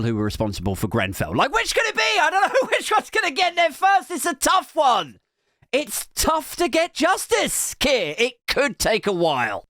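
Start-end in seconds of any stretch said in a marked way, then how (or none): 1.3-9.6 very high, worked-up mock bafflement; mock-agonised outburst. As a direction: squeal it as a hammed-up dilemma. Mock agonising over which comes first.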